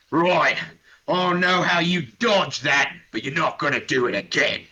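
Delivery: an orc promising not to miss again; menacing orc. Acted. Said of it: "hefts his orcish cleaver and says".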